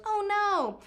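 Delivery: high, drawn-out creepy phone alert. Retold or imitated. Imitating the outbid alert for bidders, creepy voice. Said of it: "It's a really creepy voice".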